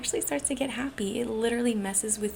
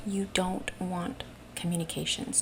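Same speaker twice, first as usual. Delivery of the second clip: ordinary talk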